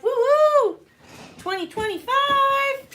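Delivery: a big celebratory yell to the chat; excited cheer. Real, not acted. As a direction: whoop for the new year, drawing it out slowly. Cheering the new year.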